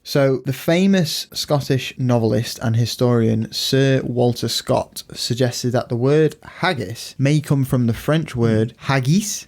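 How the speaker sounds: ordinary talk, nothing marked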